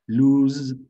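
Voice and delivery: "lose" slow and emphatic, vowel as oo; English lose. Shown as a demonstration. Teacher stressing the oo in "lose" for the class. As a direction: lean on "lose", long oo sound. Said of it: "acá es lose también".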